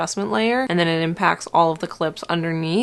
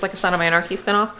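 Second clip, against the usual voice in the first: usual speaking voice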